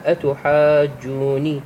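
slow أتحاجوني with the long vowel stretched before the doubled ج; slow tajweed أتحاجوني; long madd recitation. Shown as a demonstration. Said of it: "مثل قوله تعالى".

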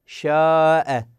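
شاء with the alef drawn out very long before the hamza; slow Quranic lengthening of شاء; long madd on the alef. Shown as a demonstration. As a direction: stretch the aa in شاء, then close on the glottal stop. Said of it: "في الألف نقول شاء".

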